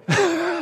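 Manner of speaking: a quick, very high chuckle; short high laugh. Real, not acted. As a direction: burst into a brief high laugh. Laughs at his own joke.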